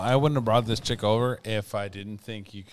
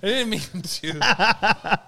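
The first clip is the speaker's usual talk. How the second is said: laughing; 8 semitones higher, much slower than usual. Real, not acted. Laughing apology.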